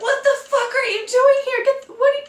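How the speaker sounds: very high, incredulous sputter; shocked squeal. Retold or imitated. Dreamer confronts her ex-boyfriend in the debris, shocked squeal. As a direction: squeal it, tripping over the words.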